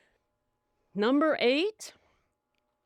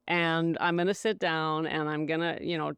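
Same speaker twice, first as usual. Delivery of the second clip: usual speaking voice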